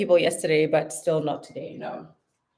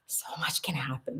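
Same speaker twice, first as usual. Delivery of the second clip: whisper; 4 semitones lower, 12 dB quieter than usual. Real, not acted. Fearing danger home alone.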